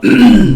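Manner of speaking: loud, exaggerated throat-clearing; phlegmy throat clear. Shown as a demonstration. Showing the breathing mark's sound, a phlegmy throat clear. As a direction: hack up some phlegm loudly. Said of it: "some good old, some phlegm".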